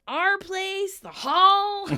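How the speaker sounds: loud, high-pitched incredulous retort; exasperated yell. Retold or imitated. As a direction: build to an indignant shout on the last word. Acted exasperated reply, climbing in volume.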